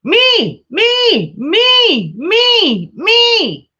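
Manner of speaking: put-on very high squeaky toddler calling me, me; baby voice. Toddler reaching up to his grandma, very high pleading baby voice. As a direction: pitch way up like a tiny boy reaching for grandma. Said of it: "and going".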